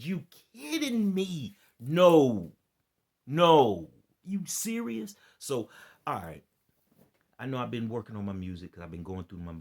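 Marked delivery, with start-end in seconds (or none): 0.0-5.2 loud, high, stunned yelling of no; blurting out shock at the news; shocked disbelief. Retold or imitated. Reacting to a comment about Kris Jenner, loud stunned disbelief. Retelling his shocked reply.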